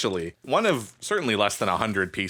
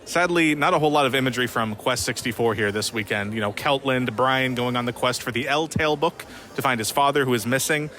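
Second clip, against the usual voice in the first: documentary narration; 3 semitones higher than usual. Acted. Voiceover for a played clip.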